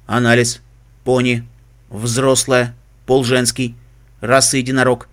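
flat mechanical analysis; robot voice. Acted. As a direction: report like a machine. Computer analysis. (механический голос)

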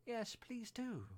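high, slow, pleading call; drawn-out begging of a little crowd; eager chorus. A narrator's reading. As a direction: chime in eagerly, pitch way up. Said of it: "joined in the chorus".

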